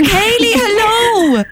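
very high sung-out hello; excited. Real, not acted. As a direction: greet her with big delight. Host greets the impressionist.

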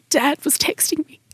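whispered, holding back tears; real tears, half-voiced; tearful whisper. Real, not acted. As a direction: push the words out past crying. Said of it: "I've never actually teared up about this".

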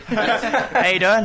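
high, drawn-out joke Irish lilt; laughing mock Irish accent; slow, high-pitched Irish impersonation; put-on Irish accent. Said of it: "That's not a bad accent".

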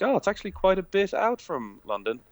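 high, far-off sudden realisation; mock realisation. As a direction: act out dawning surprise. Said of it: "and I was like".

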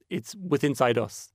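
ordinary delivery

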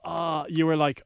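usual speaking voice

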